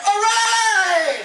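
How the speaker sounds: high, held victory shout; triumphant cheer. Retold or imitated. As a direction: celebrate the catch. Jerry celebrates catch.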